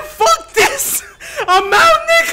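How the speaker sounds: high, loud laughing mock line; mock Kirby quote. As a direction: voice Kirby bailing out, cracking up. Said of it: "Kirby said".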